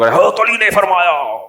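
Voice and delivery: slow, drawn-out pulpit thunder, imitated; mocking a shouting cleric. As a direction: ham up a harsh mullah's sermon voice. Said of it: "اتنی زور سے حضرت علی فرما سکتے ہیں". (گرجدار مولوی کی نقل)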